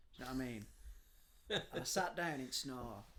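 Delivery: usual speaking voice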